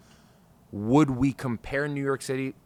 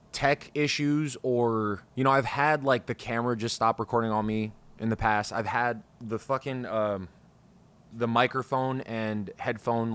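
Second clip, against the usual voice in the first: ordinary talk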